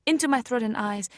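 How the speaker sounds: ordinary delivery, nothing marked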